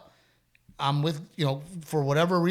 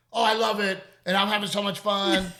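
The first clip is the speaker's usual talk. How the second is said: put-on higher, louder gushing kid; happy son; 6 semitones higher, 5 dB louder than usual. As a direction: beam like a kid after winning. Son's happy answer voiced by dad.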